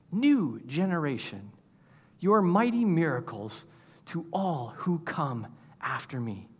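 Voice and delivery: earnest reading of a prayer psalm; prayerful scripture reading. Acted. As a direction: pray the psalm as your own words. Reads psalm as his prayer.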